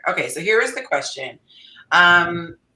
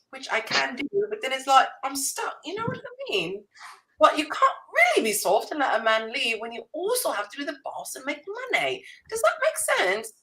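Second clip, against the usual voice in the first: higher-pitched put-on British accent; 4 semitones higher than usual. Shown as a demonstration. Host reads a viewer's question aloud in a playful British accent. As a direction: read it out in a hammed-up British accent. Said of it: "Can I do this in a British accent?".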